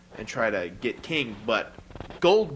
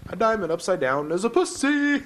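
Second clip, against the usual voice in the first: mock taunt; 8 semitones higher, 3 dB louder than usual. Gordy taunting DDP, acted quote.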